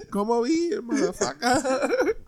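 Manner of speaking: hammed-up old man calling out; mock old man. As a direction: beckon the cops over. Old man calling cops over.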